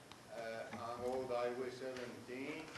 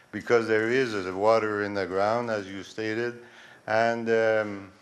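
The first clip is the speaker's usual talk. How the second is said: close-miked, much louder than before the mic; a resident asking his question over the PA, close-miked and loud; into the microphone; 2 semitones lower, 14 dB louder than usual. Real, not acted. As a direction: speak right up into the mic. Asked to speak into microphone.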